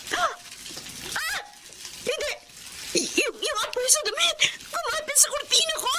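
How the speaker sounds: high panicked cries of no as the fire spreads; panicked screams. Acted. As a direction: scream in terror at the flames. (takot na takot na sigaw habang lumalaki ang apoy)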